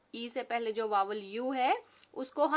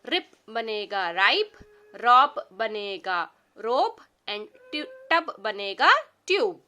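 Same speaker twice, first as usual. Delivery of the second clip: each pair read, new word punched louder; word pairs, second louder; 5 dB louder than usual. Shown as a demonstration. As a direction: show the change clearly. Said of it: "magic E words".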